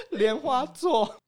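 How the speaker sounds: high, slowed wheezing word; teary laugh on the word; laughing-crying. Real, not acted. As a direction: squeeze it out through laughter. Wheezing punchline.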